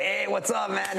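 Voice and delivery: high, loose Chicano buddy greeting; casual Chicano voice. Retold or imitated. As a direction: greet your boys in a laid-back Chicano way. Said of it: "talking a little bit more like I normally do".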